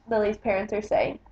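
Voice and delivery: plain reading voice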